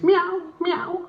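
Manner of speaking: very high cat meow. Acted. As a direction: imitate a cat in a high squeaky voice. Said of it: "the cat comes over to me".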